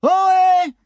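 a long, very high, loud call; shouted hail. A narrator's reading. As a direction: call out across the dark. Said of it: "Maddy continuait de hurler régulièrement". (appel crié, très aigu et fort)

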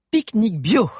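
high, loud cheerful announcing; excited announcement. A narrator's reading. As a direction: announce it proudly. Valère announces picnic.

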